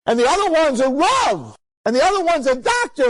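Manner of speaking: put-on high, loud beaming old man bragging; proud old survivor. Survivor boasting to Rabbi Wallerstein about his family, high proud voice. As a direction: show off each grandchild with delight, high and loud.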